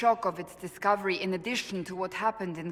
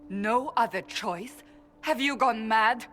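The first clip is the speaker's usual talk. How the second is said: disbelieving, rising to a louder outburst; incredulous anger; 5 semitones higher, 4 dB louder than usual. Acted. Cassandra confronting her Lord Seeker, incredulous and angry. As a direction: demand an answer from a man she thinks has lost his mind.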